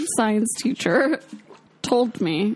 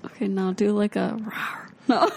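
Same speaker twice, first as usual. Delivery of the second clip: usual speaking voice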